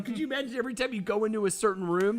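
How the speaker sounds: usual speaking voice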